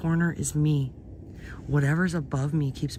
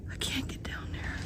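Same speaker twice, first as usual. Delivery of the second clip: very soft unvoiced whisper; barely breathing frightened whisper; terrified whisper; 11 dB quieter than usual. Real, not acted. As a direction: whisper without moving. Stuck near creature in dark.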